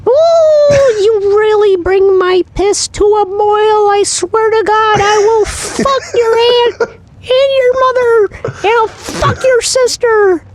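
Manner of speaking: very high, screechy villain rant; megalomaniac rant. Retold or imitated. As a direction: shriek threats like an unhinged villain. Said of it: "sound something like".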